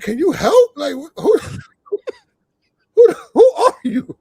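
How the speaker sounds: high, loud plea cracking into laughter; laughing plea. Retold or imitated. Manager asking the ring announcer for help, laughing high. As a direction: beg for help while breaking up laughing.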